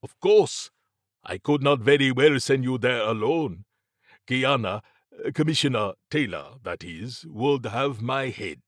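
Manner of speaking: animated formal character voice; Mabati, amiably insistent. Acted. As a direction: agree readily, then correct the name you let slip.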